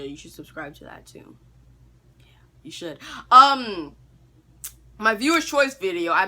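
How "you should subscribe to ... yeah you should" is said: unvoiced whisper, half to himself; whispered aside. Real, not acted. Vlogger pushing his second channel, whispered aside. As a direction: lean in and whisper it.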